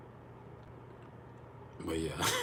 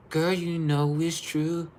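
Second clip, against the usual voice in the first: sassy; 10 semitones higher, 5 dB louder, slower than usual. Real, not acted. Teasing viewer who rolls eyes.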